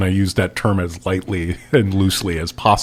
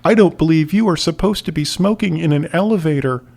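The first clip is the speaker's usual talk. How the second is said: put-on high, quick prissy child voice; prim kid; 9 semitones higher, faster than usual. Boy Barley primly scolding the smoking chef, high and quick. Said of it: "I heard Barley say".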